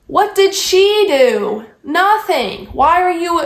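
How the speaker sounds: high, slow, emphatic challenge; indignant retort. Real, not acted. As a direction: throw it back at the friend, exasperated. Answers back to friend's excuse.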